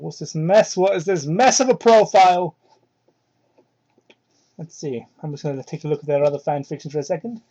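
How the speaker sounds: normal talking voice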